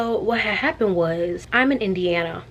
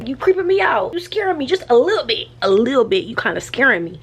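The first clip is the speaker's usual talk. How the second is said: mock spooked; 4 semitones higher than usual. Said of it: "So I was like".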